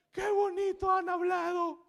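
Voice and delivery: very high, loud mock wail; crying child, imitated. Crying boy praises the reading, high loud mock wail. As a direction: gush tearfully like an overwhelmed child. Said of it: "Estaba llorando".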